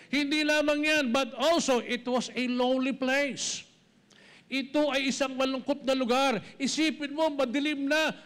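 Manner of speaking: high, emphatic preaching voice, pressing each phrase home; preaching at full force. Acted. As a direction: lift the whole passage up and preach it hard.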